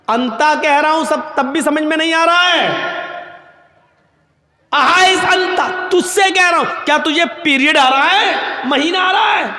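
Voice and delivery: high, loud exasperated yelling; angry shout. Retold or imitated. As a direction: scold him for not getting it. Umar scolds the man. (جھنجھلا کر ڈانٹنا)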